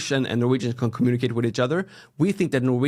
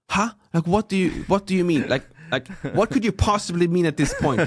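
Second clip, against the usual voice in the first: higher-pitched mock bewilderment; baffled shop staff; 6 semitones higher than usual. Drink store staff puzzled by a wrong tone, mock baffled. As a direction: imitate someone utterly failing to understand. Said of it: "And they'll be like".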